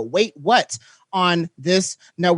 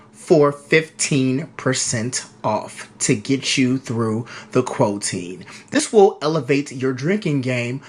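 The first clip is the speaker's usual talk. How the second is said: low, slow announcer read; sponsor ad read; 4 semitones lower, slower than usual. Acted. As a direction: read it like a paid radio spot, deliberate. Reads a sponsor spot.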